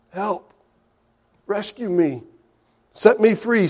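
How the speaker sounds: hushed, desperate plea to be rescued; inner cry for help. Pretend. Possessed man crying inside.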